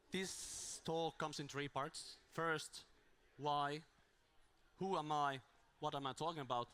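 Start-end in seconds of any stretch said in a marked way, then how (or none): none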